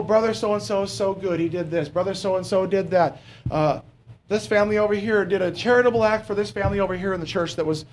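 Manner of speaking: usual speaking voice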